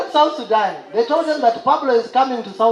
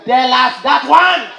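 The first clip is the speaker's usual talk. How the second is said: commanding general; 6 dB louder, slower than usual. Retold or imitated. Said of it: "The guy said".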